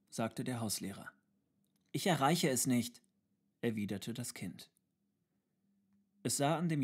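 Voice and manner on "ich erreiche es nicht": lightly voiced child, higher and louder; a hint of a child protesting; small child. A narrator's reading. (leicht angedeutete Kinderstimme, höher)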